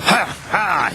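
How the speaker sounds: high, gleeful little chuckle; chuckling. A narrator's reading. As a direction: chuckle with glee. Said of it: "he chuckled".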